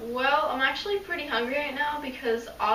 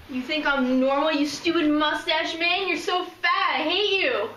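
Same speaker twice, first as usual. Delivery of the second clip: rising, higher-pitched insults hurled at him; angry outburst; 5 semitones higher than usual. Acted. Patient snapping insults at her therapist, angry. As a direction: lash out at the doctor.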